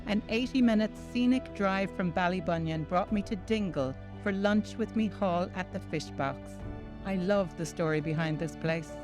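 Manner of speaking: ordinary delivery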